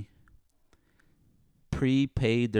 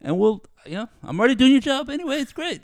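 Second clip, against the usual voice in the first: much higher and louder, fast, sarcasm boiling over; ranting on a tear; 13 semitones higher, 6 dB louder than usual. Retold or imitated. Venting at his old boss, high fast sarcastic outburst. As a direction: let the rant explode into a high, fast peak. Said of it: "we got [name] on a tear".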